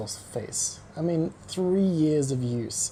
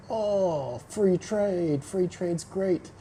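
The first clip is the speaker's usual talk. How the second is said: higher, slowing mock-cheery free trade fan; sarcastic send-up of naive optimism; mocking Canadian enthusiast; 6 semitones higher, much slower than usual. Mocking Canadians who praise free trade, higher and slowing. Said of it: "Canadian people are all about, like".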